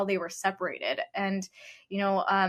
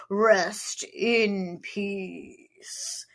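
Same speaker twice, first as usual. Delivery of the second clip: horrible Undertaker impression; much slower than usual. A podcast host mimics the Undertaker for her cohost, hammed up slow. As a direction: drag every word out like a slow funeral toll. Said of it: "It's my horrible Undertaker impression".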